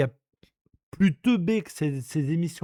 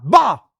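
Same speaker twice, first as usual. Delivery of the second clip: mock buzzer bah; 7 dB louder than usual. Buzzer moqueur d'élimination.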